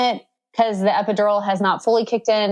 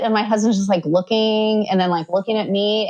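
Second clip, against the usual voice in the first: ordinary talk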